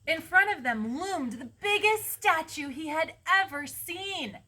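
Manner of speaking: high, emphatic storybook wonder; awed story narration. A narrator's reading. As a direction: marvel aloud at an enormous sight. Awed narration of huge statue.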